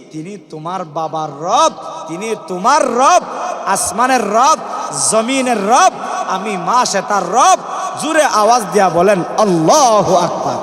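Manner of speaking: loud refrain, each phrase rising; full-force preaching. Real, not acted. Maid proclaiming the Lord of all, loud rousing preaching.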